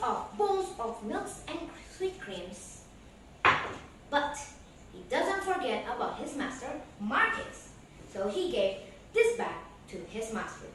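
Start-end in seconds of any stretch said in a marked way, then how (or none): none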